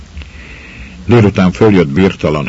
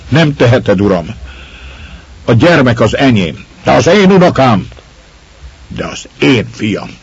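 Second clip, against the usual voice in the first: raised voices trading claims; woman and lord arguing over the child; heated quarrel; 6 semitones higher, 3 dB louder than usual. Acted. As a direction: fight for the child, word against word.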